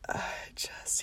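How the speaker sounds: breathless little whisper; whispered quote. Retold or imitated. Whispering nervously to her husband about meeting the moms. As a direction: trail off in a whisper.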